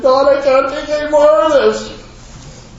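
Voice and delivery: high, slow, breaking cry to God; a desperate prayer relived, voice straining high; retold tearful plea. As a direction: plead with God, near tears, slowly. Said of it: "I started crying".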